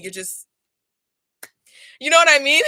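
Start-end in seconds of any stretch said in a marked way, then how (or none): 2.0-2.7 pitched up, louder, voice on the edge of crying; close to tears. Real, not acted. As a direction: cry it out in frustration. Overwhelmed, near tears.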